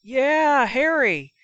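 loud, high, choked cheer; strangled cry. A narrator's reading. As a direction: cry it out, voice straining. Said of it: "Ron's strangled cry".

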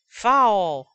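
loud sharp call of foul; referee's call. Acted. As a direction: bark it like a referee.

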